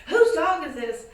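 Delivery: surprised shout. Retold or imitated. Someone in the apartment.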